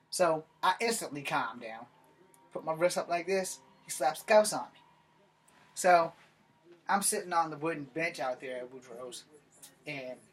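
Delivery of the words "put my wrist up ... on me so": ordinary delivery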